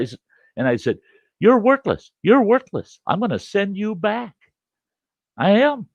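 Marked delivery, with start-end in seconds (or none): 1.4-5.9 retold high-pitched screaming at the camera; furious scolding. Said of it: "screaming and hollering".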